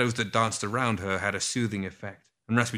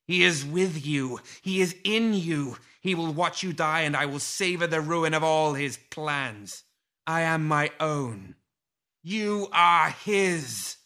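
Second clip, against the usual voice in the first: high, slow seething fury; rage; 6 semitones higher, slower than usual. A narrator's reading. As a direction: spit it out in cold rage. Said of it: "Lilith's tone turned quickly to rage".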